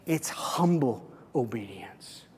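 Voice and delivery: soft, unvoiced whisper. Shown as a demonstration. Pastor hushing the key phrase for his congregation, whispered. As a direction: breathe the words, hushed. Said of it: "whispered repeat".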